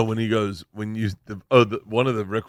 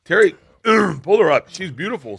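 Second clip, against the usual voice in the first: excited; 7 semitones higher than usual. Real, not acted. Gushing over the actress.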